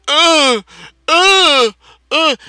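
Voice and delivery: mock gagging grunts. Retelling tasting Foster's, imitating gagging grunts. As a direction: act out choking on bad beer. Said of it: "literally sat there and went".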